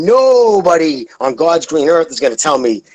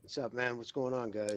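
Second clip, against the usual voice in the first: very faint, far softer greeting; barely audible; 5 semitones lower, 24 dB quieter than usual. Real, not acted. Caller just let onto the show, barely audible. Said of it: "we can barely hear you".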